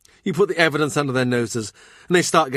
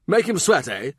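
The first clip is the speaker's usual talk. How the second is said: a touch of mocking duke, higher; mild gruff jibe; lightly voiced Brandon; 7 semitones higher than usual. A narrator's reading. Brandon scoffs.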